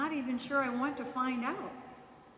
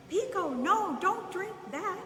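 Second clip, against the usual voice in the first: loud, high warning shout; alarmed yell; 8 semitones higher, 5 dB louder than usual. A narrator's reading. Man shouting at the dog not to drink the pool water, loud alarmed yell. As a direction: yell it to stop the dog in time. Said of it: "the man yelled".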